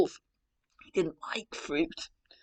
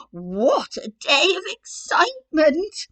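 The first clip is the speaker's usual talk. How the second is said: laughing Granny; 8 semitones higher, 7 dB louder, slower than usual. Acted. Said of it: "laughed Granny".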